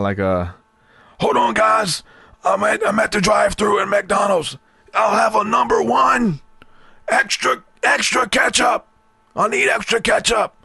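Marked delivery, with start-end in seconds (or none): 1.2-10.5 preacher voice at drive-thru. Retold or imitated. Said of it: "He's, like, talking about".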